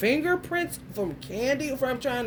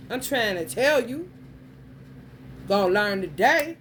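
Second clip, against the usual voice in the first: ordinary talk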